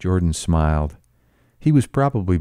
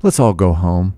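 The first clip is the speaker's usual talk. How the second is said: beg, growing more insistent; helpless pleading; 3 semitones higher, 5 dB louder than usual. A narrator's reading. Daisy begs everyone to leave, helpless and pleading. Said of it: "she interrupted helplessly".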